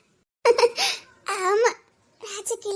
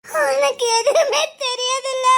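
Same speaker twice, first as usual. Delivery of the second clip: breaking into tears; 3 semitones higher, 5 dB louder, slower than usual. Acted. Woman to her friend, sobbing the repeat. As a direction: let the question crack into crying.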